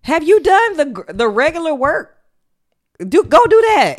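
exasperated order. Retold or imitated. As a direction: bark the order at a slacker.

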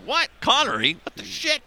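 high-pitched, incredulous reaction; mock disbelief. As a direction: act out a baffled listener, voice climbing. Acts out a startled listener.

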